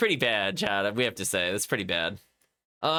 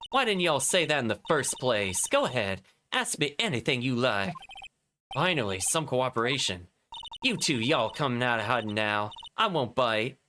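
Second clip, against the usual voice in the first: scolding country twang. Acted. Lotta voiced.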